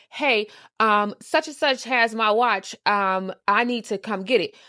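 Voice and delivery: loud mock message read; loud retelling. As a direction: announce it loudly, a bit pumped. Retelling texting the yes man.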